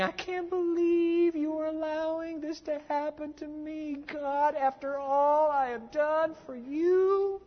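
high, slow, nasal-free mock whine to God; whining complaint. Preacher mimics a self-pitying believer whining at God, high and slow. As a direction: whine it out like a sulking child. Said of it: "you are whining".

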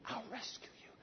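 breathy whispered promise. Acted. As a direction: lean in and whisper it. God's promise, whispered close.

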